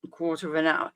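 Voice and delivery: normal talking voice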